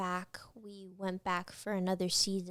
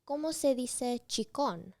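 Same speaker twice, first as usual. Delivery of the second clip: chicken in a mock Spanish accent, slightly higher; 4 semitones higher than usual. Co-star asking the Spanish word for chicken, Spanish accent. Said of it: "saying chicken in a really i-, Spanish accent".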